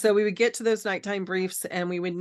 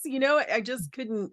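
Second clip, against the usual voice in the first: ordinary talk, nothing marked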